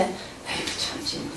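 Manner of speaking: whispered grumble to oneself; muttered whisper. Retold or imitated. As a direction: breathe it out, barely voiced, holding anger in. 속으로 참는 혼잣말. (속으로 삼키는 속삭임)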